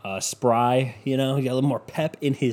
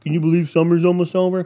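a lightly voiced grumpy old man, a bit louder; a hint of an old man griping; mock old man; 6 semitones higher, 6 dB louder than usual. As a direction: poke fun at yourself as a cranky old man. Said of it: "Fucking old man was like".

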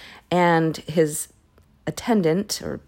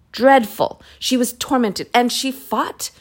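appalled outburst; 6 semitones higher, 5 dB louder than usual. Real, not acted. Reacting to the crime.

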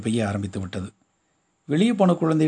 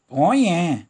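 whining; 5 semitones higher, 4 dB louder, much slower than usual. A narrator's reading. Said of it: "சினுங்கிக் கொண்டே சொன்னாள்".

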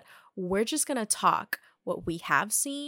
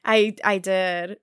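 laughing; 8 dB louder, slower than usual. Real, not acted. Admits thinking of the joke.

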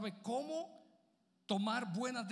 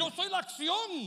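Jesus, higher and proud; 9 semitones higher, 6 dB louder, slower than usual. Acted. Pastor as Jesus proclaiming to the crowd, higher. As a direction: proclaim it as the Word himself. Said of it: "Pero si tú fueses Jesús".

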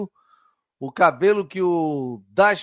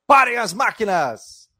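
slow, louder stop-the-presses call; old-time reporter; 4 semitones higher, 6 dB louder, slower than usual. Acted. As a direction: announce it like an old newsroom. Host announces a news segment.

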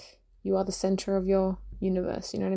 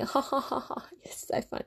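breathy laughter, then whispered taunt; whispered mocking laugh; 4 semitones higher, 6 dB quieter than usual. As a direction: laugh it off under your breath.

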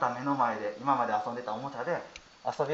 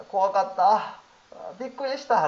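put-on higher, louder childlike plea; child's feelings voiced; 6 semitones higher, 4 dB louder than usual. Daughter's voice imagined by father, higher childlike. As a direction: voice the toddler's relief, higher. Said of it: "って".

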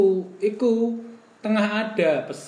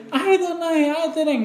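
high coaxing plea; 8 semitones higher than usual. Retold or imitated. Retold coaxing of girlfriend.

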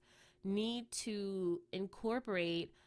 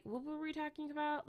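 high-pitched self-questioning aside; losing the thread; 8 semitones higher than usual. Real, not acted. Podcaster asks herself where the topic went, high-pitched. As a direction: ask yourself, pitched well up.

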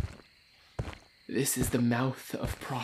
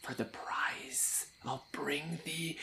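whispering; 8 dB quieter than usual. Acted. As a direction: hush them while pleading. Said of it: "Therefore speak softly".

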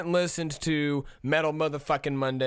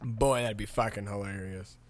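ordinary talk

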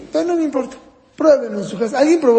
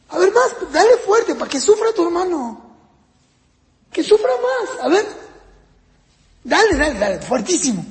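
put-on higher, egging the kid on in sarcasm; mock encouragement; 7 semitones higher than usual. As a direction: cheer on the beating, ironically. Parent mock-urging a child to hit.

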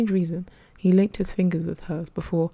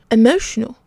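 loud, higher, disbelieving echo; raised, incredulous one-word retort; 5 semitones higher, 9 dB louder than usual. A narrator's reading. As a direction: throw the word back in disbelief. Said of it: "the stranger said incredulously".

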